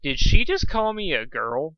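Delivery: indignant. Acted. Slow indignant realization.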